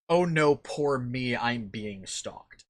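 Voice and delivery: slow, whiny poor-me act; mock victim voice. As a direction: sarcastically play the helpless victim. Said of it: "trying to use the situation as a".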